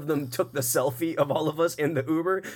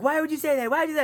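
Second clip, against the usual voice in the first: much higher mock scolding; chastising friends; much faster than usual. Friends scolding the drunk guy, high mock chiding. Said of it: "His friends start, like, chastising him".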